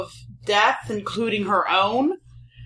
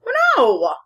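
sudden loud dismayed cry; 7 semitones higher, 6 dB louder than usual. Real, not acted. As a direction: groan of dismay at the bad news. Bad roll, disadvantage given.

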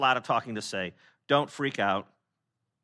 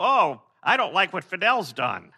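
slow, higher mock grumble; mock complaint; 5 semitones higher, 6 dB louder than usual. Preacher mimics a forbidden complaint, slow and higher. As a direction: grumble it, lightly hammed. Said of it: "you just don't go".

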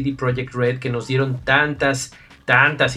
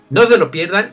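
higher, louder, emphatic plea; 6 semitones higher, 8 dB louder than usual. Real, not acted. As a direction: urge them hard, raising the voice. Repeated closing plea, louder.

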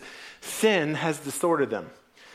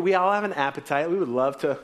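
normal talking voice

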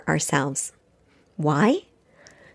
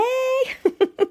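short high-pitched laugh; high giggle. Real, not acted. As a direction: giggle at your own cheer. Laughs after her own cheer.

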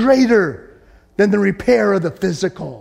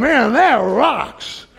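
rejoicing angels; 4 semitones higher, much slower than usual. Retold or imitated. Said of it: "They're up there in heaven going".

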